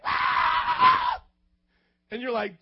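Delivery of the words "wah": very high loud karate shout; martial arts yell. Retold or imitated. Preacher imitating a dorky kid's karate shout, very high and loud. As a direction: let out a dorky kung fu cry. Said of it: "And he's like".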